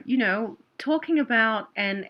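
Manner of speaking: ordinary delivery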